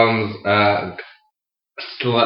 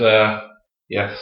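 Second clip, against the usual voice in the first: ordinary delivery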